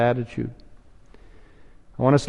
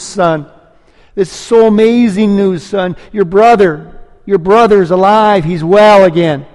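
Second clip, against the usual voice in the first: higher and louder, bursting with good news; preacher voicing an overjoyed father, higher and louder; joyful father; 5 semitones higher, 4 dB louder than usual. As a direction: let the father's joy overflow. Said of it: "The father is saying to the son".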